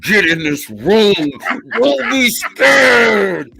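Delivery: hammed-up nurse voice. Nurse ordering him into the room, screeching high. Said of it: "I wonder if she went in that voice".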